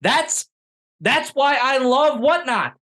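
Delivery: high, emphatic, insistent; excited high protest. Real, not acted. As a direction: pitch way up defending it. Defending Whatnot pricing.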